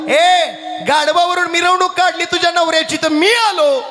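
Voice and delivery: high, shouted comeback; heated retort. Acted. देव जोरात प्रत्युत्तर देतो. (आवाज चढवून उलट उत्तर)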